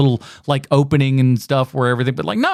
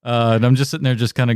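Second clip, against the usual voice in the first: ordinary delivery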